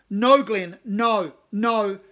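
loud exasperated refusal. Acted. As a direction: shout him down. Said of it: "Cave says loudly".

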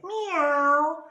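high, loud meow; cat meow. A narrator's reading. Cat scares the robbers, high loud meow. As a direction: yowl like a cat. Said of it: "The cat said".

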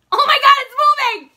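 real high, loud panicked scream; freaking out. Real, not acted. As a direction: shriek in terror at a moving bug. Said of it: "I'm freaking out".

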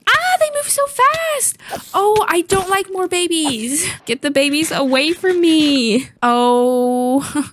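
high frightened squeals. Real, not acted. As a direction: shriek as they close in. Fast baby mobs swarm her.

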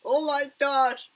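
loud, high, drawn-out cry; amazed exclamation. Real, not acted. Reacting to the levitation clip, amazed exclamation. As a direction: cry out high after a laughing fit. Reaction straight after bursting out laughing.

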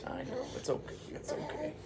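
high soft whispered comfort; soothing. Real, not acted. Soothing baby mid-story.

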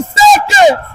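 long, high shout across the court; calling from far away. Real, not acted. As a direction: stretch it out, calling to a far player. Coach yelling across the court.

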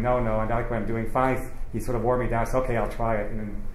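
normal talking voice

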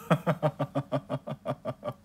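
long, even giggle; laugh. Real, not acted. Joking with viewers about the toothpaste, a long giggle. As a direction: let out a run of quick giggles. Laughing at own veneers joke.

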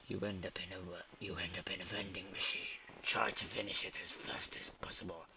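whispered title read, quick and breathy; level reading whisper. Acted. Gamer whispering the level description to viewers.

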